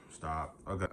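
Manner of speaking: ordinary delivery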